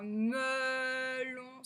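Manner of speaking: MELON with a long "me"; elongated first syllable. Shown as a demonstration. Teacher shows the sound e, "melon" stretched at the start. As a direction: hold the e of "melon" slowly. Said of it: "c'est aussi le son e".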